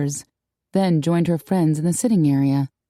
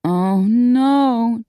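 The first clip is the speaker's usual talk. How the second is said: raised, drawn-out groan; groaned dismay; 6 semitones higher, 4 dB louder than usual. A narrator's reading. Friend realizing she forgot the interview, groaning in dismay. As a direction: groan it with sinking dread. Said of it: "Darcy groaned".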